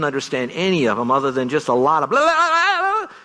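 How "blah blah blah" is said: high, loud mock crowd babble. Pastor mimicking thousands of men shouting at once, loud babble. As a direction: imitate a roaring stadium mumble.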